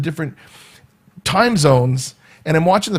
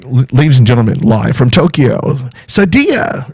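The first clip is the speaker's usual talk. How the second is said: loud, booming host intro; show announcer; 2 semitones lower, 6 dB louder than usual. Shown as a demonstration. Host shows his viewers how he announces the act, loud emcee call.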